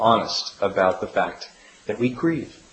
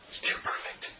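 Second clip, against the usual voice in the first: whisper; 14 dB quieter than usual. Retold or imitated. Quoted confession, whispered.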